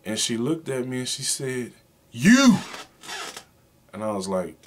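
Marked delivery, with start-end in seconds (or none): 2.1-2.6 a sudden high, loud "You!"; wife's sharp one-word accusation; blurted accusation. Retold or imitated. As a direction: blurt it out at him. Wife finally blurts the answer.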